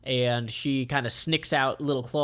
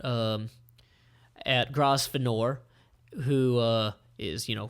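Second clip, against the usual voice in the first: plain reading voice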